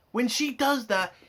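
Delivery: ordinary delivery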